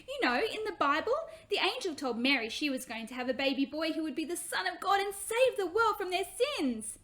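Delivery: put-on a little girl who can't hold in the story; excited little girl. Hannah excitedly tells Bible story.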